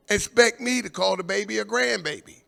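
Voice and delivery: ordinary talk, nothing marked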